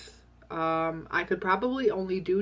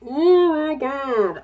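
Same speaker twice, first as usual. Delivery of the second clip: slow, high, loud yawn through the words; groaning through a real yawn; 8 semitones higher, 9 dB louder, much slower than usual. Real, not acted. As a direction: stretch it out in a big yawn. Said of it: "I shouldn't be yawning like this".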